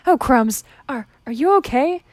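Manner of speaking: loud, high flustered alarm; panicking. Acted. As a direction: blurt it out in a panic. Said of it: "panicking at Charlie's sudden outburst".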